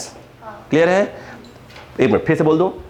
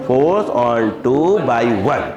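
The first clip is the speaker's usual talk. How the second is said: very slow, word by word; lower, drawn-out teacher's dictation; 5 semitones lower, much slower than usual. Shown as a demonstration. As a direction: stretch each word out for note-takers. Teacher dictating the reversed notation.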